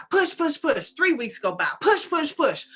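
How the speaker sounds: ordinary delivery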